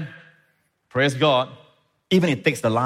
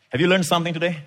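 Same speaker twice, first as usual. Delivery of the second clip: high, raised-voice exhortation to a congregation; rally the church with high, sustained urgency; impassioned preaching; 9 semitones higher, 3 dB louder than usual. Real, not acted. Preacher urging the church to truly serve the Lord, impassioned preaching.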